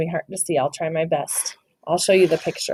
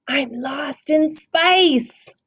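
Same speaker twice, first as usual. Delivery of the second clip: slow, higher, worried wail; 8 semitones higher, much slower than usual. Acted. Scipito realizes he is lost, worried. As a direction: let the fear creep in.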